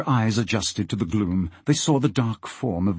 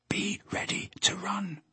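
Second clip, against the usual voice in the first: very soft voiceless warning; whisper; 10 semitones higher, 10 dB quieter than usual. A narrator's reading. Alf warns Peter before breaking the lock, whispered. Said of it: "he whispered to Peter".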